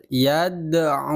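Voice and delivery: "Yad'u" with the d released in a bounce, "ya-da-u"; bouncing dal. Shown as a demonstration. Teacher models the bouncing dal for pupils. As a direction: let the d in "Yad'u" rebound. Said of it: "Jadi memantul".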